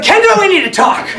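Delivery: high, loud, laughing-through grandpa; blunt announcement. Retold or imitated. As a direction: barge in and announce it. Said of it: "He's like".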